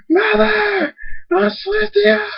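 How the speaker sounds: very high, drawn-out cries; anguished wail. Acted. Thunderstorm in tears calling for mother and aunt, high wail. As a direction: wail for lost family. Lands in tears, calls lost family.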